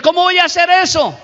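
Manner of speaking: high, quick incredulous question; protesting climber. Retold or imitated.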